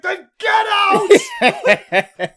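high, drawn-out furious yell; imitating an enraged interrogator's bellow; shouted dismissal. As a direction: scream them out of the room. Retold interrogator throwing him out.

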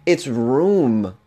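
"room" in a Boston accent. Shown as a demonstration. Comedian showing how Boston says "room" in a Boston accent. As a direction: say "room" the Boston way, rounded and odd. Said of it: "I say room very strangely".

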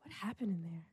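hushed, mock-dazed question; under the breath, as if stunned. Acts dazed after concert.

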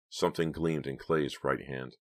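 ordinary delivery, nothing marked

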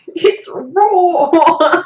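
loud, high dinosaur bellow through laughter; Gordon Ramsay. Retold or imitated. Imitating chef on underdone food, laughing dinosaur roar. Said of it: "really weird dinosaur impression".

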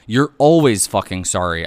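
loud, furious retort; yelling back. A narrator's reading. Wife sick of husband's apologies, yelling back. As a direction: yell it back, fed up. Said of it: "I yelled back".

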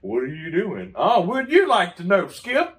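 mock back-and-forth. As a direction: play both sides, teasing.

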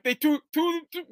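put-on high stuttering little boy voice; stammering kid. Kid stammering an answer to dad, high and flustered. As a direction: trip over the word like a flustered child. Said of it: "And I'm like".

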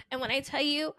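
ordinary talk

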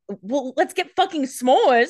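hammed-up eager camper; mock gung-ho Rebecca. Cohost quoting Rebecca craving s'mores, mock gung-ho. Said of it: "now she's like".

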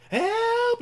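high, loud mock scream for help; cry for help. Heroine screams for help at the intruder, loud and high. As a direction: yell it out as if in danger.

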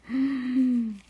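sharp startled gasp. Acted. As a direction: gasp in fright. Dramatic gasp.

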